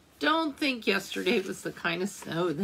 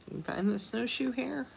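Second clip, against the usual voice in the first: ordinary delivery, nothing marked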